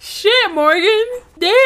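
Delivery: high, loud shocked outburst. Real, not acted. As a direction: blurt it out in shock. Reacts to graphic detail.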